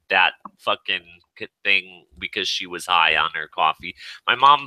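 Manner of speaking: usual speaking voice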